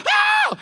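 sudden very high-pitched whoop; imitated church shout. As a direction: mimic a worshipper's high shout. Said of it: "start shouting".

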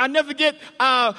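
ordinary talk, nothing marked

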